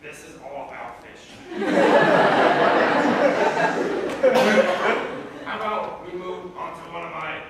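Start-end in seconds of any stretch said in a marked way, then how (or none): 1.4-5.4 airy burst of laughing, much louder; loud breathy laughter. Real, not acted. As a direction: crack up at how dull it was. Laughs after fish passage.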